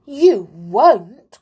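loud, horrified disbelief; appalled, forceful protest; horrified cry. A narrator's reading. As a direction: cry out aghast at a threat. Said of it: "cried the clown in horror".

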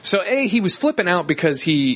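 ordinary talk, nothing marked

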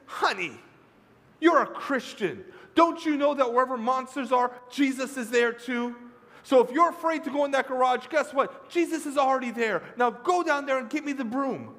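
high, loud, insistent lecture; exasperated mother. Acted. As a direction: scold a scared child into obeying. Mother loses patience with son.

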